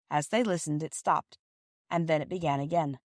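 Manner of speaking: ordinary delivery, nothing marked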